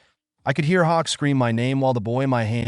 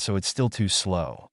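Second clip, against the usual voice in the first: ordinary delivery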